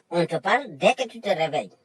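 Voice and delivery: ordinary talk, nothing marked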